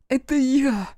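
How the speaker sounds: высокий отчаянный вой; воет, умоляя высоко. A narrator's reading. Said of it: "завыла Амелия". (Амелия воет, умоляя Люка)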